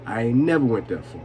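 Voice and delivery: ordinary talk, nothing marked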